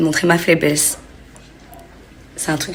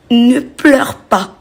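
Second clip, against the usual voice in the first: inner command; 6 semitones higher, 5 dB louder, much slower than usual. Retold or imitated. Inner command holding back tears.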